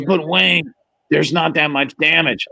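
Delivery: slow robotic monotone of a voice box; electrolarynx voice. Retold or imitated. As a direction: imitate a man speaking through a throat device. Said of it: "it's one of those voice things".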